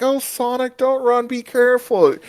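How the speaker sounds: high, whiny worried plea; whiny Morty. Retold or imitated. Chris imitated pleading with Sonic, high and whiny like Morty. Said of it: "that same whiny Mortyism".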